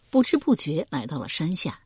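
usual speaking voice

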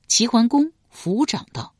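ordinary delivery

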